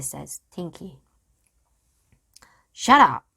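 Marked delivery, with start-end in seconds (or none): 2.7-3.3 loud snapped order; roared. A narrator's reading. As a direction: silence the crew loudly. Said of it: "Captain Bones roared at his men".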